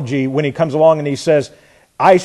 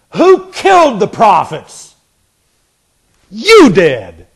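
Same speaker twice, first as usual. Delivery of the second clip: louder, higher pointed retort; imagined retort; 4 semitones higher, 6 dB louder, slower than usual. Pretend. Preacher voicing the answer Jesus might have given the Pharisees, louder and higher. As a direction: fire it back as the accusation Jesus could have made. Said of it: "He could have said that".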